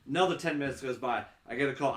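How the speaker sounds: ordinary talk, nothing marked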